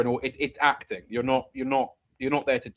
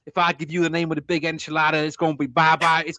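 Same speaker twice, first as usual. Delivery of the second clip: higher, louder jive-talking New Orleans lawyer; a New Orleans lawyer's hip patter; Dean Andrews impression; 5 semitones higher, 7 dB louder than usual. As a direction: ham up a fast-talking hipster lawyer. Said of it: "Dean Andrews, who's played by John Candy".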